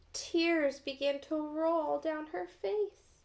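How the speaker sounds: plain reading voice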